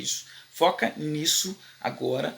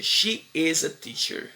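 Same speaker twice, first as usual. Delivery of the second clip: slow statement model; slower than usual. Shown as a demonstration. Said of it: "Eu tô afirmando".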